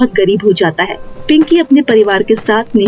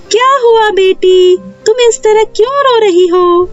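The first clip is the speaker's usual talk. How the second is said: concerned fairy queen; 11 semitones higher than usual. Acted. Fairy queen asks a crying girl what happened, concerned high voice. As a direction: ask with sweet concern, pitched far up.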